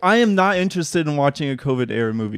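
worked-up refusal. Pretend. Co-host told to get angry about covid movies, worked-up refusal. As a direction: dig in and refuse, voice climbing.

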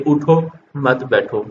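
उठो, a short stop, then मत बैठो; pause after उठो. Shown as a demonstration. Teacher giving students an Urdu example, pause after उठो. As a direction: break after उठो, keep मत बैठो together. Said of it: "पर ठहरना लाजिम है".

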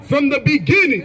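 high, ringing verse reading; scripture declamation. Real, not acted. Reader accusing an opponent through scripture, declamatory.

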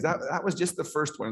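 ordinary talk

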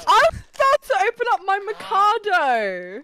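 high, loud delighted squeal; excited squeal. Real, not acted. Friends squealing over snacks before the wedding, high excited.

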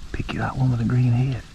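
low, very soft coaching under the breath; hushed instructions. Real, not acted. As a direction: keep it low so nothing hears you. Coaching child as ducks approach.

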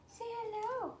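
hushed sing-song "Say hello." to the dog. Real, not acted. Coaxing dog to greet viewers.

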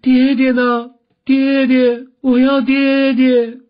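high, loud, drawn-out wail; wailing cry. A narrator's reading. Daughter wakes to news of father's death, wailing aloud. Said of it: "大哭叫道".

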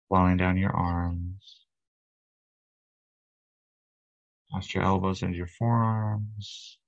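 calm, unhurried meditation guide; guided meditation. Acted. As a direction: lead them into relaxation, leaving long pauses between phrases. Leads guided breathing and relaxation meditation.

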